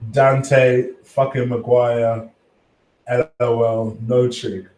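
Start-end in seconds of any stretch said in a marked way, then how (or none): none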